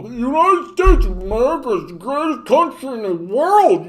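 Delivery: high, loud dopey patriot voice; mock American braggart. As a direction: boast in a silly, swooping caricature.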